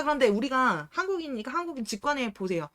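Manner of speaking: ordinary talk, nothing marked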